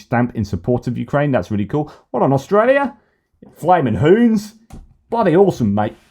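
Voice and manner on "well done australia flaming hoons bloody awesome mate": mock Australian accent, much higher. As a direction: cheer like an Aussie mate. Mock Aussie praise.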